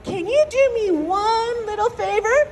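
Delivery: high-pitched begging; pleading rabbit. Acted. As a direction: plead for one little favor before being eaten. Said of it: "Mister Rabbit goes".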